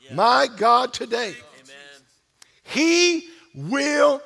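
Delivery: rising, pounding sermon cadence; preaching at full force. Acted. Pastor preaching to church, building fervent refrain. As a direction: drive the refrain home to the congregation.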